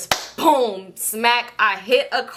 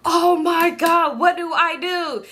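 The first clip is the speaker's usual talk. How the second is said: high, slow, drawn-out wail; helpless high-pitched fretting, stretched out; acted panic; 7 semitones higher, much slower than usual. Driver who just hit a car, acted panic.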